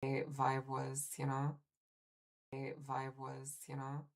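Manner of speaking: fading delayed echo of the voice; quiet repeat from a second device. Real, not acted. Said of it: "Sorry for the double audio".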